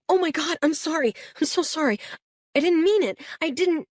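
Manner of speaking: high, rushed panicky apology; flustered apology. Acted. Boy bumps the officer and frantically apologizes. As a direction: blurt sorry over and over, alarmed.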